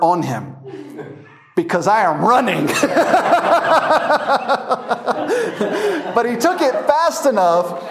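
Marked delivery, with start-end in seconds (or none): 2.7-6.1 high, long burst of laughter; cracking up at the memory; big laugh. Real, not acted. Preacher laughing at his own tiger photo.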